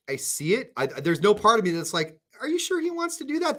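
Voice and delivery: ordinary talk, nothing marked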